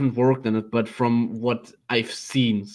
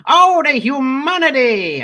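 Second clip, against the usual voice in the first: put-on old newsreel announcer wailing, much higher; 1950s announcer; 8 dB louder, slower than usual. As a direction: Hindenburg radio announcer cry. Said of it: "this fifties announcer guy".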